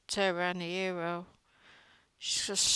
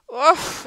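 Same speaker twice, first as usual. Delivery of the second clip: exasperated oof; 8 semitones higher, 9 dB louder than usual. Real, not acted. Imagining Ruby as her own child.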